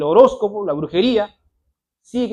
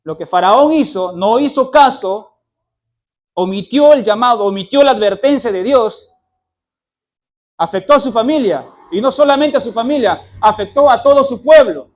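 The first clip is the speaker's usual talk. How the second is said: loud, hammering sermon climax; fiery preaching; 3 semitones higher, 9 dB louder than usual. Acted. Preacher warning how Pharaoh's sin hit his whole people, loud and forceful. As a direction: pound each phrase from the pulpit.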